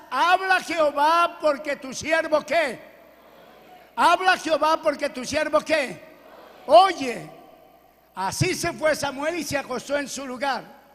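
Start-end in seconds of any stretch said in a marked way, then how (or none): 0.1-7.3 loud, slow scripture proclamation; emphatic preaching. Real, not acted. Preacher prompting the congregation to finish the verse, loud and emphatic. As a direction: punch each phrase out loudly, prompting a response.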